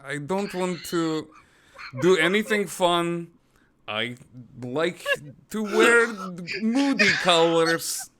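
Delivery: slow, heavy mock Russian accent; mock Russian accent, slow and moody. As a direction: ham up a brooding Russian tough guy. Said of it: "I can do Russian".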